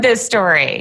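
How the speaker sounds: ordinary talk, nothing marked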